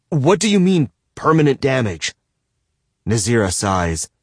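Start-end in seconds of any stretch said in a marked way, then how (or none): 0.1-2.2 alarmed. A narrator's reading. Man to captor about friends, alarmed. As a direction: demand an answer in alarm.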